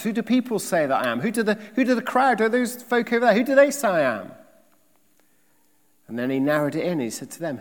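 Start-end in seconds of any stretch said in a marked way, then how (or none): 0.0-4.3 higher, fast, loud questioning voice; Jesus asking. Retold or imitated. Jesus asking his disciples who people say he is, higher and fast. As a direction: fire the questions up high and quick. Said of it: "He said to his disciples".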